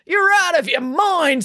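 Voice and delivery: high, slow outburst of disbelief; incredulous outburst. Acted. Coach Voss to April swinging at him, incredulous outburst. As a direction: exclaim in disbelief, drawing it out.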